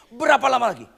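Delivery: high, loud exasperated cry; angry repeat. Acted. Preacher repeating Jesus's angry question, loud and high. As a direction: fling the question out angrily. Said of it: "Itu marah besar".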